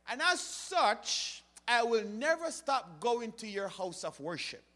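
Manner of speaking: fervent sermon delivery; preaching. Acted. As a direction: drive the point home to the congregation. Preaching at full force.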